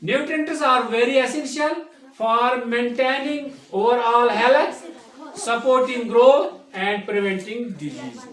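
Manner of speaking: reading the English definition slowly, word by word; teacher reading a textbook definition aloud; slow dictation reading. Shown as a demonstration. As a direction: dictate it slowly so the class can write.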